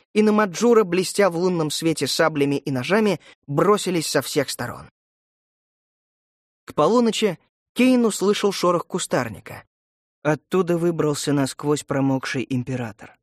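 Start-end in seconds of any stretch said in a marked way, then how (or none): none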